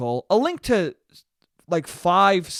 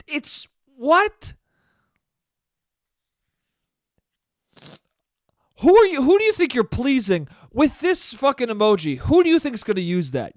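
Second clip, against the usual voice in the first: high-pitched, incredulous comic rant; mock outrage; 7 semitones higher, 3 dB louder than usual. Italian podcaster scolding Apple over the emoji, mock outraged. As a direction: sputter in disbelief, playing up the indignation.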